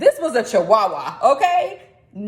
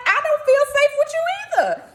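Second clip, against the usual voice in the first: sassy retort; faster than usual. Retold or imitated. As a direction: snap back at the baby, half-joking. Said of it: "And I'm like".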